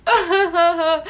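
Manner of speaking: loud, high burst of crying laughter; dramatic laugh-cry. Shown as a demonstration. Acting class exercise, loud dramatic laugh-cry. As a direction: wail it out, half laughing half sobbing. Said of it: "you're dramatically like".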